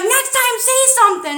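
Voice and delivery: scolding yell. Retold or imitated. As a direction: snap the order at her, high and loud. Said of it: "I'm like".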